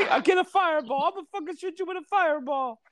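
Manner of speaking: high squeaky cartoon voice; cartoon plumber with squeaky road rage; Mario threat.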